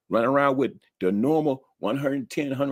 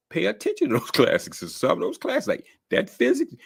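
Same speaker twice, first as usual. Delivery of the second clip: ordinary talk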